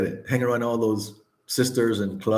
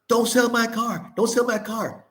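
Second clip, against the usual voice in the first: much higher, hammed-up mock scream imitating the ex-wife; imitating a frantic plea, pitched way up; 8 semitones higher than usual. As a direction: shriek it like she's chasing the car down the street, retold. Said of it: "she's screaming behind the car".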